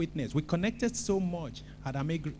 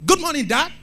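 bright high loud good morning; joyful morning hello; cheerful greeting; 9 semitones higher, 12 dB louder than usual. Shown as a demonstration. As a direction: greet him like a glad child. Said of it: "I get up, I say".

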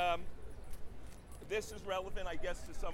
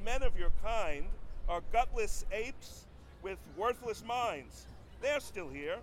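higher-pitched rhythmic protest recital; spoken-word poem; 5 semitones higher, 8 dB louder than usual. Acted. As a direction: recite it as a pointed satirical poem with a repeated refrain. Performs his satirical poem.

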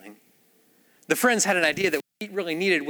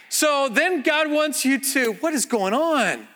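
mock baffled interruption; 9 semitones higher, slower than usual. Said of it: "even Jesus has gotta be like".

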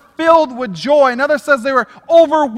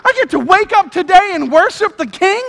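excited; 6 semitones higher, 3 dB louder than usual. Pretend. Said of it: "you should be like".

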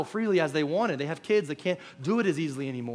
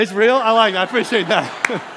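louder, higher, laughing through the words; amused at the crowd's reply; laughing surprise; 5 semitones higher, 12 dB louder than usual. Real, not acted. Pastor reacting to the congregation, laughing and surprised.

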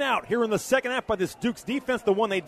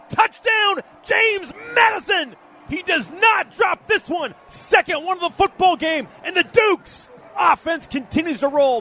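excited; 7 semitones higher, 5 dB louder than usual. Real, not acted. Touchdown catch called.

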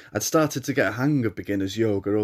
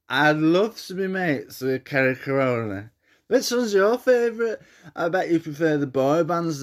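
put-on tipsy old man voice; 6 semitones higher than usual. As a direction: slur it like a tipsy pub bore. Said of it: "his tipsy, nasal renditions".

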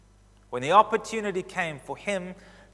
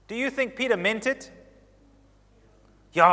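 usual speaking voice

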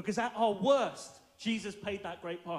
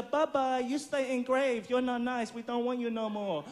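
mock farewell taunt; 4 semitones higher than usual. Jesus taunts the sinful nature.

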